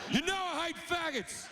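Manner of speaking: high offended deity; petulant God. Acted. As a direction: spit it out like a tantrum. God's outburst acted.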